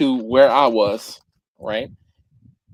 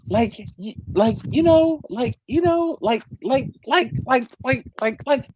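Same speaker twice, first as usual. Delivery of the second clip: high, stuttering string of likes; daughter's filler-word habit; 8 semitones higher than usual. Retold or imitated. Imitating his daughter starting sentences, high halting chatter. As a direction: mimic a kid stuck on filler words. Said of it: "you know how people like my do".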